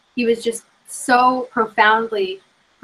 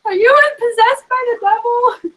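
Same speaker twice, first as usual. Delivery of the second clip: much higher, laughing, incredulous; playful startled disbelief, pitched up; mock alarmed; 14 semitones higher, slower than usual. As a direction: tease as if spooked by a possessed friend. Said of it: "And she's like".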